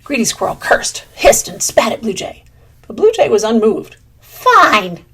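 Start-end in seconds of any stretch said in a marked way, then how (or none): 4.2-5.0 loud angry bark of a word; yelled fine. Acted. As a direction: spit out the word. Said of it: "yelled Greedy Squirrel".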